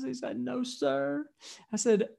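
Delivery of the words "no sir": higher, soft imitation of a tearful child; mock crying. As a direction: answer meekly as a crying little boy. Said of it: "he's still crying".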